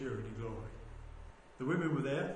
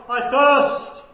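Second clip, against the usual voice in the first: loud, higher cry of thirst; 9 semitones higher, 19 dB louder than usual. Retold or imitated. Preacher voicing Jesus on the cross, crying out. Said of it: "he cries out from the cross".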